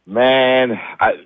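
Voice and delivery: ordinary talk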